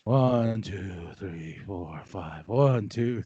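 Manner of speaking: low, soft, self-soothing count; calming count. Retold or imitated. Manager telling coworker it's okay, low restrained counting. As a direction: count quietly to hold the temper in. Retells counting to stay composed.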